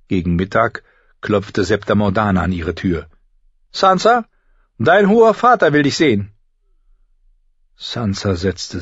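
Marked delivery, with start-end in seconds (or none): 3.7-4.3 high, loud name with rising end; calling at the door. Acted. Septa knocks at noon, calling the girl questioningly. Governess calls through the door. 4.8-6.3 high, loud septa voice; summoning governess. Acted. Septa summons girl to her father, calling out.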